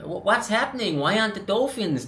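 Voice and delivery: high, whiny mock American accent. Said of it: "apologies for the accent".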